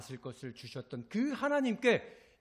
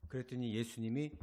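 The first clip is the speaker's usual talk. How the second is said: ordinary delivery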